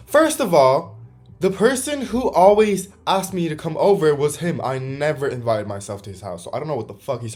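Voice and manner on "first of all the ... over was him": loud, high indignant correction; really riled, pitched up and forceful; worked-up anger. Real, not acted. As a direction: set the record straight, raised and heated. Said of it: "I need to calm down".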